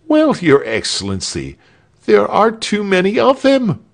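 high, rising, emphatic complaint; aggrieved petitioner. A narrator's reading. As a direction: protest to the governor, climbing high and loud.

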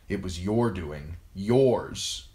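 plain reading voice

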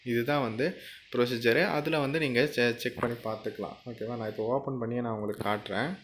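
normal talking voice

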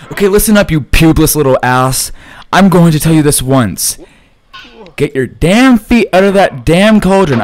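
loud ranting scold; yelling at the screen in rage; furious yell. Real, not acted. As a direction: bellow at the game character. Ranting at game character.

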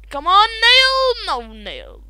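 normal talking voice

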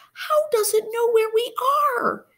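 high panicky question; frightened question. Acted. Marie to Andrew, high frightened voice. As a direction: ask it terrified. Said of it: "asked Marie".

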